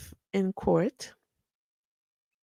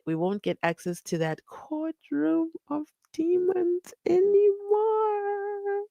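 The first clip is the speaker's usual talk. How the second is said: drawn-out, rising high voice; theatrical read-out; 10 semitones higher, slower than usual. Acted. Host reads a viewer's comment about the courtroom, theatrical. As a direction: stretch the last words higher and higher, very slowly.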